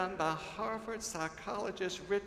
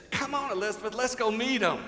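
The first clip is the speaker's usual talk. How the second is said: put-on a little girl's bright eager call, louder; 6 semitones higher, 7 dB louder than usual. Granddaughter Georgia urging her friend to greet newcomers, eager. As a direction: tug a friend along, excited.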